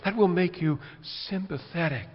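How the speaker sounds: ordinary talk, nothing marked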